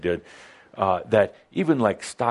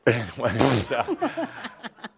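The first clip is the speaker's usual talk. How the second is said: laugh; 3 semitones higher than usual. Real, not acted. Laughing at married-too-long joke.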